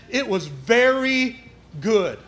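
slow and weighty, leaning on very; solemn quote. Acted. Preacher quotes God's verdict to the church, slow and weighty. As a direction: let each word land slowly.

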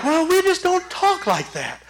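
high-pitched mock prim churchgoer; disapproving church folk. Church members correcting a new convert, in high mock disapproval. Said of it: "They're like".

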